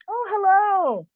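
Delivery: high, sing-song hello; cheerful greeting. Retold or imitated. Storyteller imitates lady greeting the shop, cheerful greeting. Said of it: "she's all cheerful".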